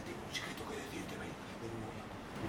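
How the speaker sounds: unvoiced, low whisper; whispered secret. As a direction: share a secret with the child. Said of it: "y yo decía". (susurro de secreto a un niño)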